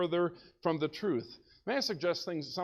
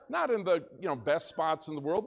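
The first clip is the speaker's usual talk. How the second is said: usual speaking voice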